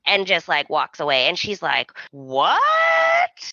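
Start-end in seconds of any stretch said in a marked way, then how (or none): 2.1-3.5 very high, drawn-out squeal of disbelief; hammed-up shocked wife; mock shock. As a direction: stretch it out sky-high, incredulous. Retold wife's shocked reaction.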